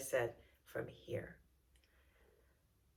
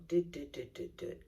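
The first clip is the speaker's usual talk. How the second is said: flat droning whisper on one note; monotone; 3 semitones lower, 5 dB quieter, faster than usual. Shown as a demonstration. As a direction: drone the same note over and over. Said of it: "It can get very monotone".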